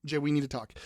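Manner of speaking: like a partner about to break bad news; mock ominous. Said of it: "I didn't say we had to have a bad talk".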